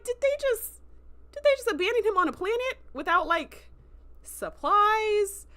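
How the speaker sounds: high-pitched, aghast disbelief; incredulous outrage. Retold or imitated. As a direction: question it as a scandalized viewer. Reenacts shocked viewer reaction.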